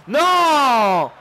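exasperated gamer outburst; frustrated yell. Real, not acted. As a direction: yell it in real dismay. Goal conceded in live match.

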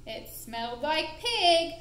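ordinary delivery

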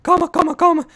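high, rushed calm-down in panic; mock panic. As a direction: fake panic, rising high. Panicking during game. (pânico fingido, agudo)

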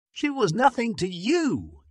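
high, loud furious yell; an angry nurse yelling at the husband. A narrator's reading. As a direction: hurl it at him in anger. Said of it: "the woman yelled".

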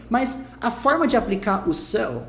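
ordinary talk, nothing marked

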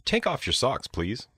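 ordinary talk

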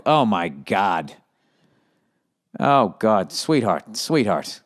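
low, drawn-out pity; pitying sigh. Real, not acted. Pitying reaction to letter writer.

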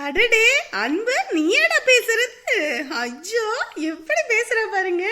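slow, high, loud, laughing with delight; drawn-out laughing delight at the child; aunt greeting a little child, laughing with delight. Acted.

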